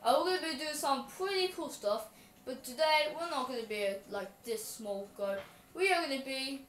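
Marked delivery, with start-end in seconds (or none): none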